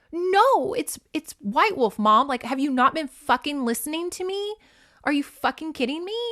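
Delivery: put-on high-pitched, indignant teen protest; mock exasperated outburst. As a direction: act out an outraged daughter at her mom. Retold daughter argues with her mom.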